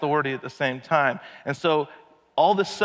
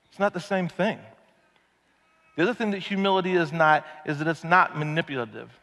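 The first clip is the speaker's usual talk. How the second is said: ordinary talk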